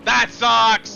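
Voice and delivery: loud frustrated shout; real frustrated outburst; frustrated yell. Real, not acted. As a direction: yell it out as the race slips away. Losing the race.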